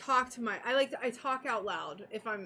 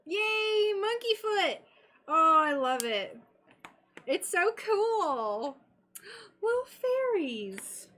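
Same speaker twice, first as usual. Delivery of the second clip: high, gleeful squeal of delight; delighted excitement; 7 semitones higher, 4 dB louder, slower than usual. Real, not acted. Streamer cooing over fairies and monkey foot, delighted. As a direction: gush in a high voice, drawing out the last words.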